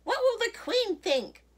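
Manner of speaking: loud, very high aghast cry; horrified storybook outburst. A narrator's reading. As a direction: exclaim it, utterly scandalised. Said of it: "Missus McSnoot cried".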